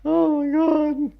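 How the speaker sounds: very high, slow laughing gasp of words; helpless laughter. Real, not acted. Gamer recovering from own taunt, high laughing voice. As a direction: squeeze it out through real laughter.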